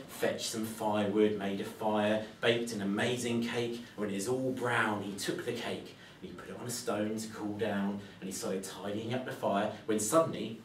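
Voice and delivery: ordinary delivery, nothing marked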